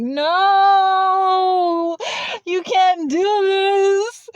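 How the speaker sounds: hammed-up, very high drawn-out wail; loud, slow mock sobbing protest, each word stretched; crying wail. Handcuffed and pleading with the cop, hammed-up crying wail. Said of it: "'Cause I'm just crying".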